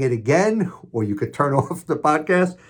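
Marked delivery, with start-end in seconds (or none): none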